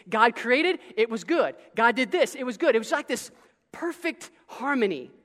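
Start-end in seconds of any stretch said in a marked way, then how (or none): none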